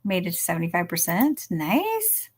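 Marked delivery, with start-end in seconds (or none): 1.5-2.3 high squeaky nice. Real, not acted. Reacting to chat progress.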